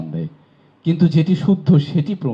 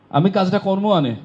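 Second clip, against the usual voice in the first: Barishal Bengali dialect, pitched higher, with কর্ম আনে for করব; 6 semitones higher than usual. Shown as a demonstration. Teacher showing the class the Barishal regional variant, Barishal dialect. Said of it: "এটি বরিশালে যদি আঞ্চলিক হয়".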